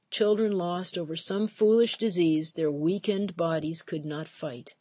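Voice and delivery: plain reading voice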